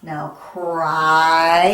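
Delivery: loud teacher model of cry; stretched cry. Shown as a demonstration. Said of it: "you hear the I?".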